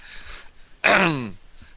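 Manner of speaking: rough throat clear after a voice crack. Real, not acted. Guest with a cold clears his throat mid-sentence. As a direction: clear the throat hard to recover the voice. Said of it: "excuse me".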